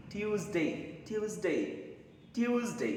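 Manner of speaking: ट्यूसडे, yoo then a z sound; Tuesday drilled. Shown as a demonstration. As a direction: repeat ट्यूसडे as tyooz-day. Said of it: "हम इसको पढ़ेंगे".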